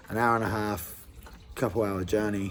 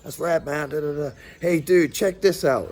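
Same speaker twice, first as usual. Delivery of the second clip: excited rocker; 5 semitones higher than usual. Retold or imitated. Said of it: "He's like".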